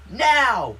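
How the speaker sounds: one loud, high barked order; sharp imitated shout from upstairs; angry neighbor yelling. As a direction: yell it as an impatient demand. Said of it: "the upstairs neighbor yelled".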